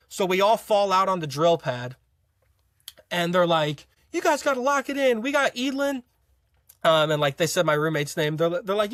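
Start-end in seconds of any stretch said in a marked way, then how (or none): 4.1-6.0 high-pitched imitation of a reprimand; mock scolding instructor. Instructors calling out a recruit before the squadron, mock scolding. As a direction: scold the group in a raised mock voice.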